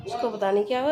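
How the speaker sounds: ordinary talk, nothing marked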